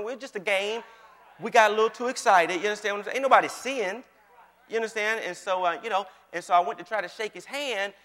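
usual speaking voice

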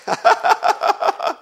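rapid staccato high laugh; high laughing outburst. Real, not acted. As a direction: burst into a high, pulsing laugh. Outburst after preached climax.

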